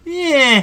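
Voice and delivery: high, drawn-out unsure "eh"; imitated groan of doubt; noncommittal "eh". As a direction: waver on a long "eh". Imitates the groom's noncommittal noise.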